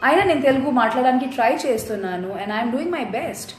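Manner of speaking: ordinary delivery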